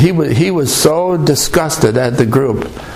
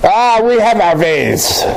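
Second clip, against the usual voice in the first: put-on slow German accent; 4 semitones higher than usual. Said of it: "with her German accent".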